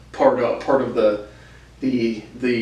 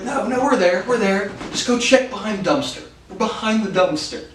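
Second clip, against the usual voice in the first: higher-pitched mock insistence; lightly acted, pitched-up reassurance; prank radio reply; 7 semitones higher than usual. Retelling their prank radio replies.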